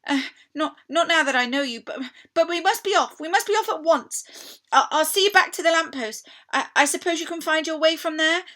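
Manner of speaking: high, loud, hurried fawn; urgent fawn. Acted. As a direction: rush her off to safety. Tumnus deciding to free Lucy.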